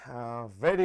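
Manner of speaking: ordinary talk, nothing marked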